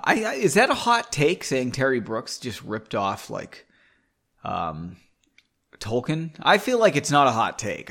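ordinary delivery, nothing marked

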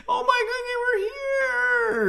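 panicked wailing cry; terrified cry. Acted. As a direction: shriek it out in horror, slowly. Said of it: "in an almost fearful voice".

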